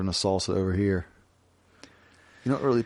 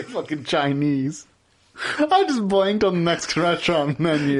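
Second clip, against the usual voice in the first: higher Wisconsin voice, breaking character; mock Wisconsin server losing the bit; Wisconsin accent; 5 semitones higher than usual. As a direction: stay in the Wisconsin accent while fumbling. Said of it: "Wisconsin accent".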